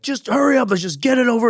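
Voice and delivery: high put-on impatient voice; impatient coworker. Coworker urging him to join, high impatient mock voice.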